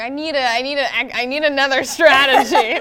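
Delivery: loud, laughing declaration. Retold or imitated. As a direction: laugh while announcing it. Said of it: "I was like".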